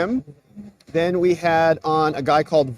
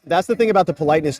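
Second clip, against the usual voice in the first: ordinary talk, nothing marked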